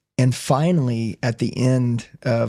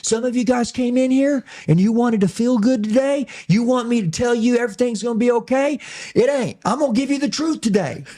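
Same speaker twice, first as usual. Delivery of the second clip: high-pitched, hard-driving preacher impression; yelling preacher; 8 semitones higher than usual. Preacher challenging his congregation with hard truth, fired-up preacher voice. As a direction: imitate a blunt preacher working the room. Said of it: "preacher talk just like this".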